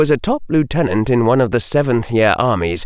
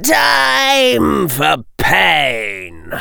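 drawn-out, higher-pitched villain's cry; menacing threat; 8 semitones higher, much slower than usual. Acted. Jugson announces attack on the girls, drawn-out menace. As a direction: stretch each word into a threat.

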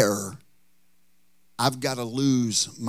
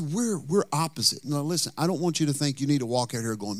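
normal talking voice

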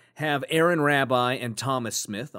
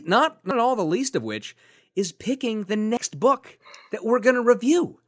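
sarcastic excitement; 10 semitones higher, 4 dB louder than usual. Acted. Sarcastic excitement about next book.

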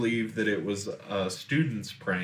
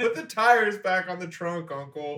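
scolding shout; 9 semitones higher than usual. Acted. Scolding the drunk uncle.

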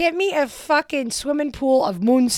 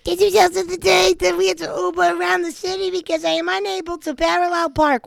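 higher, louder put-on voice for the read; a comic character voice, higher and louder; 8 semitones higher, 6 dB louder than usual. Shown as a demonstration. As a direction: read it in a silly voice that makes it flow. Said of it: "Why is it so easy to do it when you talk like that".

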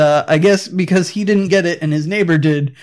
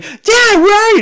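high-pitched mock kid whine; Jake Lloyd freaking out; 14 semitones higher, 4 dB louder than usual. Kid freaking out at dad on phone, mock high child voice. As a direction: imitate a frantic child, pitched way up. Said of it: "Jake Lloyd just freaks out on him".

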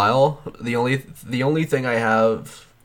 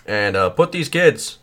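halting presidential impression; Barack Obama; 3 semitones higher than usual. Comic addresses the nation as Obama, halting mock oratory. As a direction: speak in short bursts with pauses between. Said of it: "the key to talking like Barack Obama".

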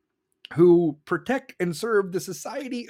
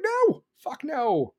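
high, drawn-out outraged no; emphatic mock refusal; 8 semitones higher, much slower than usual. Voters answering a ballot on defunding cops, emphatic refusal. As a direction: stretch out a high, indignant refusal.

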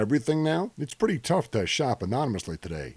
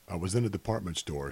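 ordinary delivery

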